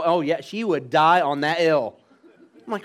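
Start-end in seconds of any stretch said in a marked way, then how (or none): none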